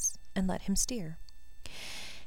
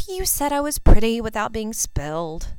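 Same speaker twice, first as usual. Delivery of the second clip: ordinary delivery, nothing marked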